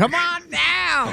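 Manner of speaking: loud, high, slow ad shout; furniture ad pitchman. Retold or imitated. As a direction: belt it like a TV salesman. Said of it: "know Bob's Furniture".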